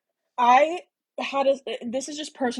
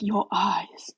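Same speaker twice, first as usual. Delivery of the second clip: seductive whisper; a sultry whispered line; breathy whisper; 2 semitones lower than usual. Retold or imitated.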